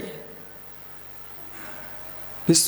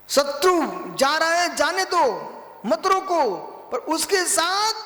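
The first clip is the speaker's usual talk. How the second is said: high, drawn-out exhortation; sustained high-pitched sermon cry; impassioned preaching; 11 semitones higher, slower than usual. Real, not acted. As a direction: preach it high, stretching the final words.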